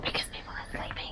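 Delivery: hushed whisper, fast; whispering on the phone. Real, not acted. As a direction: whisper so the sleepers stay asleep. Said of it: "Why are you whispering?".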